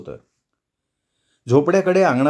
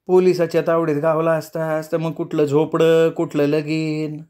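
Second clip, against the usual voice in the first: Amma fretting; 4 semitones higher than usual. Acted. Amma warns her son, worried, slowing at the end. Amma frets over the police.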